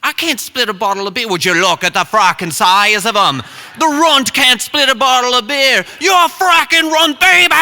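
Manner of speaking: put-on Irish accent, building from plain volume to a high shout; hammed-up, incensed Irish heckling; incensed Irishman. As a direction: work up into an outraged Irish rant.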